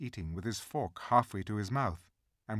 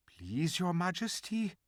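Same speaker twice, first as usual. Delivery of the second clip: put-on timid old fox; 6 semitones higher than usual. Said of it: "said the fox".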